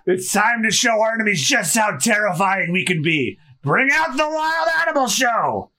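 villainous boast rising into a big call; Buggy rallying his crew. Acted. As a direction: build to a ringmaster's high announcement.

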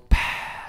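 long, slow breath out; slow exhale. Shown as a demonstration. Fighter demonstrating his pre-fight breathing, slow exhale. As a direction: let a held breath out slowly. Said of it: "Super slow exhales".

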